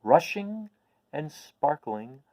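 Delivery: bright animated story reading; storybook narration. Acted. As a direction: paint the scene with wonder, reading to children. Reads the book chapter aloud.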